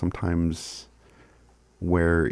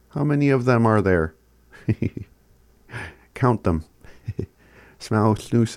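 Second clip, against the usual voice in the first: usual speaking voice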